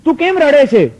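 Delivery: a startled husband rushing to his crying wife; worried husband. Acted. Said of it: "તરત જ આત્મારામ પૂછે છે કે".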